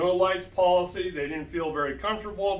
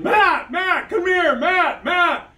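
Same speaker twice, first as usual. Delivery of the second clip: higher, louder retold yell; yelling for Matt; 10 semitones higher, 6 dB louder, slower than usual. Scared guests on the logging road calling out, retold yell. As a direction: call him urgently from down the road. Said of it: "they're yelling".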